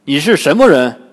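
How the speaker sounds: loud, demanding shout; loud challenge. A narrator's reading. Friar Sha confronts the suspicious girl, loud challenge. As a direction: challenge a stranger at full voice. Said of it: "大声对那姑娘喊道".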